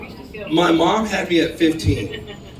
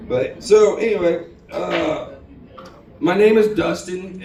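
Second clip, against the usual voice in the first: normal talking voice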